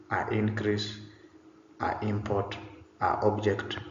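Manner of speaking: "a increase", "a import", "a object" with a bare a before each vowel; wrong article demo. Shown as a demonstration. Teacher lists awkward wrong forms for students, demo. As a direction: list the wrong forms with plain a, no n. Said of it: "Such words don't make sense in English".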